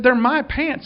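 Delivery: man claiming the pants, high whiny mock protest; petulant man. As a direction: whine it like a stubborn man. Man's petulant reply voiced.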